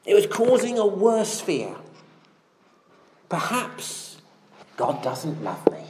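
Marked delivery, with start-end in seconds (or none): 3.3-5.8 whispered, voiced David's secret doubt; fearful whisper. Preacher whispering David's secret fear to God. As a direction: breathe it like a fear you dare not say.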